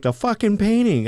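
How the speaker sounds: high, urgent imitation of wife; urgent hiss of alarm. Wife in the haunted hotel urging him to look, high and alarmed. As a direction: point it out in alarm. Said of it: "She's like".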